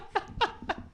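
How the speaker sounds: high, soft wheezy laugh. Real, not acted. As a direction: break up laughing mid-rant. Own laugh during rant.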